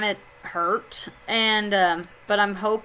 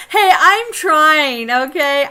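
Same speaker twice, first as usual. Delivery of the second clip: mock defensive; 5 semitones higher, 8 dB louder, slower than usual. Real, not acted. Defensive reply to chat teasing.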